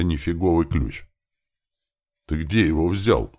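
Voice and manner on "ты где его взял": mildly surprised question; understated puzzlement. Acted. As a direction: ask with a touch of surprise. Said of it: "удивлённо спросил я".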